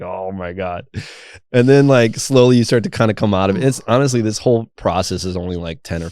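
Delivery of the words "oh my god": low, soft, drained murmur; spent and limp. Retold or imitated. Recalling lying limp on the ground, softly wiped out. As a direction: let it sink, like after total release.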